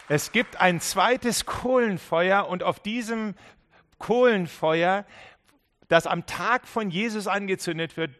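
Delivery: loud, high, fervent sermon climax; preaching at full force. Acted. Preacher rousing the congregation, loud fervent proclamation. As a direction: proclaim it to the back row.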